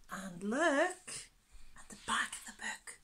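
fast hushed whisper. Real, not acted. Reader telling children where the pictures are, whispered. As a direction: whisper a secret to the listeners.

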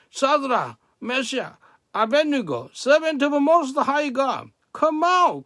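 high, loud calling out; shouting. A narrator's reading. King calls the men out of the blazing furnace, shouting. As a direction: shout each name out, pitch leaping high. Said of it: "and shouted".

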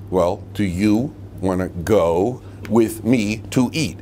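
over-explain it slowly like she's missed the obvious; slow exasperated repeat. Retold or imitated. Husband repeats his dinner offer to wife, slow and exasperated. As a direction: drag each word out slowly, spelling it out for her. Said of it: "what wasn't clear about what I said?".

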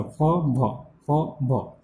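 "फ, भ" with lip on teeth; labiodental demo. Shown as a demonstration. Teacher repeating the sounds in Hindi. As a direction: repeat "फ, भ" softly. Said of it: "कौन सा था?".

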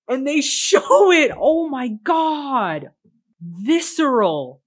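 loud, slow, drawn-out disbelief; stunned excitement. Real, not acted. Viewer stunned the film shows the murder, loud slow disbelief. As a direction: stretch each word in shock.

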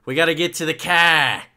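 put-on Boston accent. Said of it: "if you go to Boston".

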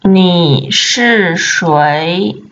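ordinary delivery

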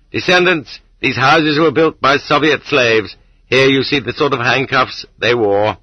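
solemn proclamation. A narrator's reading. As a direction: proclaim it like a message carved for the future. Hidden note to future descendants.

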